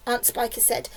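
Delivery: ordinary delivery, nothing marked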